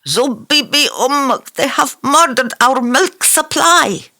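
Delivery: high, loud anguished cry; loud wail. Acted. Fareed over the slaughtered goats, crying out loud. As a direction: let it fly from the throat. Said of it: "let fly his throat".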